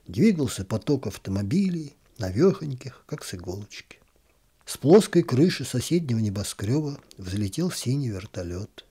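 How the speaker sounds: usual speaking voice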